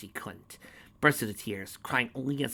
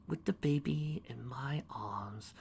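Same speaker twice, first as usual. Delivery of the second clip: slow, sinister hush; creepy whisper; 3 semitones higher, 7 dB quieter, slower than usual. Acted. Storyteller whispering the dark deed, slow whisper. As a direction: whisper it like a confession.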